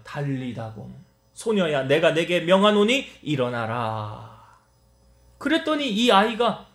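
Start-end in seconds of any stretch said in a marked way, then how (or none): 0.0-4.4 slow, low, grave command; solemn proclamation. Acted. Jesus commands the dead girl to rise, slow and solemn. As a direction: speak it as a holy decree, unhurried. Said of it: "선포하십니다".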